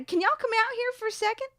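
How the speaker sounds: much too high dad voice; high-pitched Southern attempt at dad; botched dad impression. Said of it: "that is not his accent. He's way lower voice".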